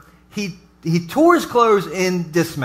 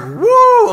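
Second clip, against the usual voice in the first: high, loud comic whoop; mock outraged whoop; 6 dB louder than usual. Pastor mimicking angry Bible folks, a loud high mock whoop. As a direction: yelp like someone furious. Bible people getting mad.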